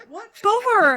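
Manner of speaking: high and loud, in disbelief; startled question. Retold or imitated. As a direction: blurt it out in shock. Teacher reacts to chapstick mishap.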